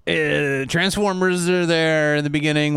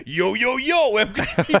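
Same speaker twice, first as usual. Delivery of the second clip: hip MTV host; 5 semitones higher than usual. Retold or imitated. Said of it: "Transformers show up and they're like".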